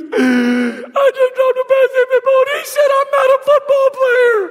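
put-on high, wailing sobbing boy; crying kid. Father retelling his crying son's complaint, high sobbing. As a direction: wail it like a hurt little boy. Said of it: "He goes".

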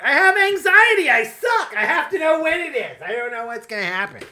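high, squeaky panicky self-parody; mock anxious self. Mocking herself to cohosts in a high panicky voice. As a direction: squeal it like a nervous wreck. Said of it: "I'm just like".

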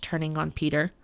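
ordinary delivery, nothing marked

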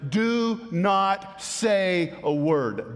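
very slow, every word weighted; slow, grave command do not say a word; stern command. Retold or imitated. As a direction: lay down the order word by word, very slowly. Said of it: "strictly charged them and commanded them".

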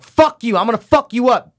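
loud, high mock-angry swearing; sweary outburst. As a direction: imitate her hurling curses at full volume. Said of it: "She's swearing".

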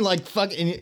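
ordinary talk

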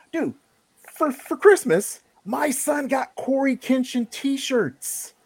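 high-pitched, astonished rant; incredulous. Real, not acted. Dad baffled that kids buy YouTuber t-shirts, incredulous.

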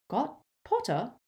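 sharp. A narrator's reading. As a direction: snap the question. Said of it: "said Professor McGonagall sharply".

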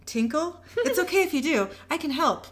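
high, over-eager coaxing; coy helpfulness. A narrator's reading. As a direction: offer help in a sugary high voice. Becky coyly offers captive help.

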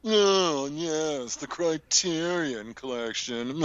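slow, drawn-out snooty voice. Pretend. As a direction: say it like a film snob. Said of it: "you to do a snooty voice about".

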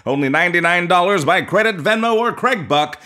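loud, fast ad pitch; announcer voice. Acted. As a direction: hype it like an infomercial. Mock ad read.

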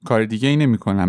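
usual speaking voice

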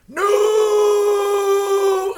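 huge high blasting no; blunt shout. Retold or imitated. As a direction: blast the no like a gust of wind. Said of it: "very bluntly".

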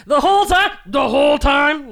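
high, loud quote, slowing down; Mrs Doubtfire voice. Acted. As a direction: belt the movie line.

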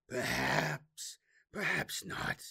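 sly, teasing demon voice. A narrator's reading. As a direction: toy with him, playful demon.

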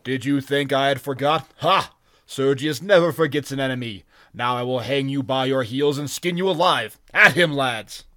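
loud, hateful threat; hateful roar. Acted. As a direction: roar your threat at the enemy. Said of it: "glittering with hate".

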